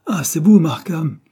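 put-on a cornered boy attacking first; indignant outburst. A narrator's reading. Cyril confronts Markham. (Cyril apostrophe Markham avec indignation, beaucoup plus fort)

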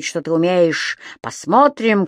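ordinary delivery

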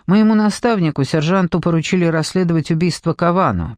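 ordinary delivery, nothing marked

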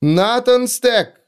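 slow, high call from far away; distant calling voice. A narrator's reading. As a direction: call the name across a great distance. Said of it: "как кто-то произнёс его имя".

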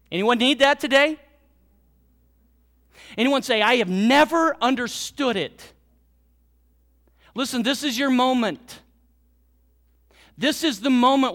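loud, ringing preacher voice speaking as God; preaching at full force. Acted. Pastor voicing God to the congregation, loud and ringing. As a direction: proclaim it to the whole church.

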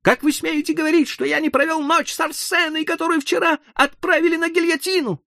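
high, pleading, desperate voice; frantic protest. A narrator's reading. Hoffmann protesting to the crowd, frantic and high. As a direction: hurl it at the doctor, frantic.

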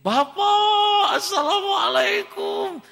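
very high, loud, drawn-out call home; joyful call. Acted. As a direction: shout a happy greeting running in. Boy runs home calling father. (memanggil bapak dengan gembira dan keras)

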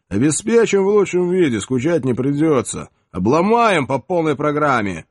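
loud, cocky Cossack bravado; bold Cossack. Acted. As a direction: boast like a fearless Cossack.

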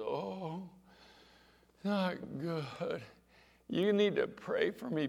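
high, drawn-out mock moan; woeful moan. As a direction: imitate a suffering mother's plaintive plea. Mother on phone sounding unwell, retold.

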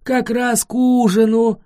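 loud, high, hungry booming call; dragon roar. A narrator's reading. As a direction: boom it out gleefully. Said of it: "проревел тот же голос".

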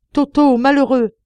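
put-on high, loud strangled cry at her child; choked cry. A narrator's reading. Mother cries out to her thieving son, choked and loud. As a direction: call out to the boy in sudden horror. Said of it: "d'une voix étranglée qu'elle cria".

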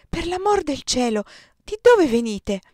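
high, loud burst of surprise; astonished exclamation. A narrator's reading. Host to two night arrivals, astonished. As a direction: exclaim in amazement at unexpected visitors.

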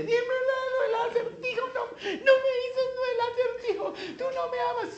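very high falsetto wailing; hammed-up tearful whine; mock crying. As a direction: imitate a nagging woman bawling and pleading. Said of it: "siete días llorándole".

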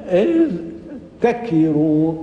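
إذ تكروا slowly, dh and t kept apart; original unmerged form. Shown as a demonstration. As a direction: split إذ تكروا into idh, pause, takiru. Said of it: "أصل".

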